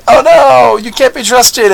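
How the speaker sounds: a pretend soldier's startled panic; mock alarmed protest. Soldier realising he is betrayed, mock alarm. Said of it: "If he would have said".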